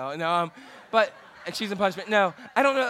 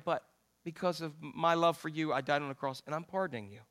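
softer, fading to a near hush; Jesus pardoning the sinner, soft and tender; 6 semitones lower, 10 dB quieter than usual. Acted. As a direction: offer pardon gently, like a whisper of love.

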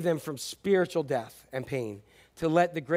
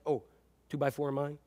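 ordinary delivery

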